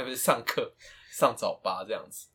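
ordinary delivery

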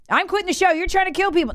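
high, whiny mock of an angry listener; imitating an outraged fan. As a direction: sneer out the caller's accusation. Mocking angry listeners in audience.

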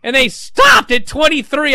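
loud, high indignant outburst; worked-up fan venting, loud and emphatic; appalled. Real, not acted. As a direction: protest with outraged disbelief. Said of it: "I was appalled".

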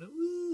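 high short woo; a crowd's woo call; mock woo. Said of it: "they just went".

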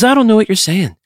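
high-pitched exasperated outburst; comic high whine of bafflement; mock exasperation. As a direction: complain in a squeaky, exasperated voice. Mock rant at the British.

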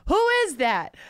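high, loud "Who is that?" yelled at the screen; mock yell. Audience member yelling at a new cat on screen, high mock yell. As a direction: yell it like a startled moviegoer. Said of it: "you can yell".

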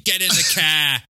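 mock Boston accent, called out; imitation bad Boston accent; Martin Sheen. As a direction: bark it with a dropped R in a Boston accent. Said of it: "a New York accent besides saying".

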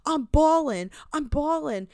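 high, loud imitation of bragging; hammed-up combative swagger; mock aggressive boasting. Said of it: "being very aggressive and being like".

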